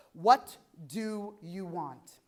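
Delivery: very slow, word by word; deliberate question. Real, not acted. Speaker asking listeners to sit with themselves, slow and deliberate. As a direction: let each word of the question sink in. Same question repeated for reflection.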